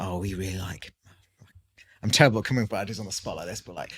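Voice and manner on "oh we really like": whispered imitation of an excited couple; soft mock whisper of a customer idea. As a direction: breathe it out as a hushed client quote. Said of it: "And it's all about".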